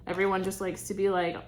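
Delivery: ordinary delivery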